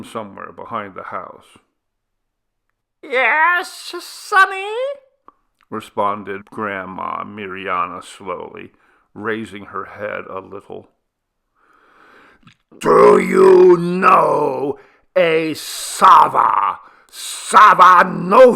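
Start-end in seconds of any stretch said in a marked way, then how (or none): 3.0-4.7 put-on very high, thin old granny voice; old woman voice. A narrator's reading. Grandmother answering the shouting men slowly. 12.8-18.5 very loud, slow, high shouted question. A narrator's reading. Villager shouting the question to the deaf grandmother. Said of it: "shouted Chebo again".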